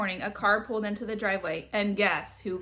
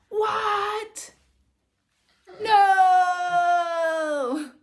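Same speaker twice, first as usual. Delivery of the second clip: high, loud wail of protest; horrified shout; 9 semitones higher, 8 dB louder than usual. Acted. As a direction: cry out in pure dismay. Kid hears unwanted housemate news.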